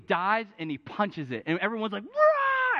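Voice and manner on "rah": high, loud stadium roar; mock fan cheer; crowd cheer. Crowd cheering a goalie save.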